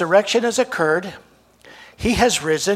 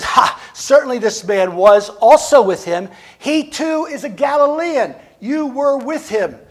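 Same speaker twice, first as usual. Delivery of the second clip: mocking accuser; 5 semitones higher, 6 dB louder than usual. Another mocks and accuses Peter.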